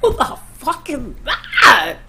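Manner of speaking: mock startled disbelief; caught off guard. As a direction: re-enact a taken-aback reaction. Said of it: "I was so caught off guard".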